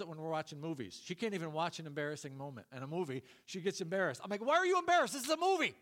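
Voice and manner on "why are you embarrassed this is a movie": high, loud, incredulous outburst; exasperated yell. Retold or imitated. Husband to wife embarrassed by a movie, hammed-up exasperated yell.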